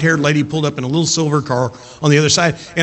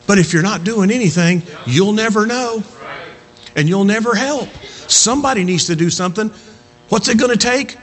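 higher, driving pulpit voice; impassioned preaching; 7 semitones higher than usual. Acted. Preacher urging church to keep witnessing, impassioned. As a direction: press it home with rising passion.

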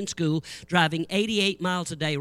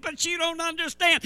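high pleading voice; pleading young pastor; 10 semitones higher than usual. Retold or imitated. Assistant pastor to the preacher, high pleading.

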